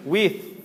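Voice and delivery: "with" ending on English TH. Shown as a demonstration. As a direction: finish "with" with the tongue at the teeth. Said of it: "é como no inglês". ("with" com TH do inglês)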